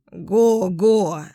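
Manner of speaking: a lightly voiced goose call; gander honking. A narrator's reading. Gander calling his flock, goose honk. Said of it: "закричал гусак".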